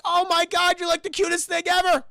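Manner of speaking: loud, high mock-kid excitement; hammed-up adoring outburst; gushing delight. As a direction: squeal over the cutest pet. Billy gushes over Gizmo.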